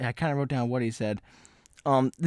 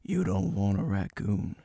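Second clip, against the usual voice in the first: low, slow stranger's warning; ominous strange guy; 5 semitones lower, 5 dB quieter than usual. Retold or imitated. Strange guy warning off a raccoon lover, low and ominous. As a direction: warn slowly in a low, dramatic voice. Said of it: "He's talking like this".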